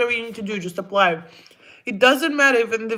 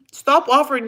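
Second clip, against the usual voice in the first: exasperated outburst; 6 semitones higher, 6 dB louder than usual. Real, not acted. Annoyed at viewers offering money.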